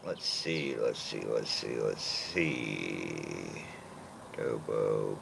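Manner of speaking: musing under the breath; trailing mumble. Real, not acted. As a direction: voice sinking low and soft while thinking. Thinking aloud, voice sinking low.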